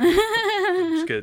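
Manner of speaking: loud, high laughing talk. Real, not acted. Both laughing about MySpace.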